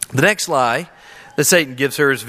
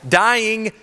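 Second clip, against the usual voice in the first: raised, weighty stress on die; emphatic; 7 semitones higher, much slower than usual. Shown as a demonstration. Preacher giving God's command, emphatic. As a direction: press down on Dying and die. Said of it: "very emphatic".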